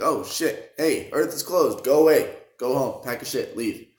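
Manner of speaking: hammed-up panic yell; mock alarmed shouting. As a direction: shout them off the planet, half joking. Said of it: "they're like".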